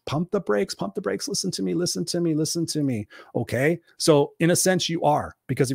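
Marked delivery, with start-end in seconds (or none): 0.1-3.1 urgent calming. Retold or imitated. Coach reining in a pissed-off client, urgent calming. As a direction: talk him down insistently.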